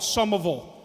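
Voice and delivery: "Somerville" said the Boston way, R dropped; Boston-accented "Somerville" as "Summaville". Shown as a demonstration. Comic quoting mom's hometown, Boston accent. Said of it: "the Boston accent".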